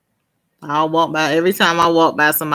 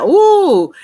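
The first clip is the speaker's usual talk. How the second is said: high, loud drawn-out ooh; real disgusted ooh; 12 semitones higher, 8 dB louder than usual. Real, not acted. Woman recalling handshakes, loud squeamish ooh. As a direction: let out a high ooh of revulsion.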